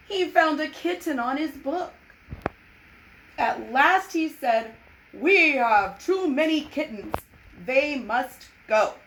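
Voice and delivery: lively read-aloud storytelling; children's story reading; story narration. A narrator's reading. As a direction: read it like a picture book to kids.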